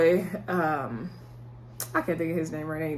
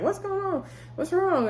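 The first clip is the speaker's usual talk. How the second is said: worried mom; 12 semitones higher than usual. Retold or imitated. Mother sees crying daughter.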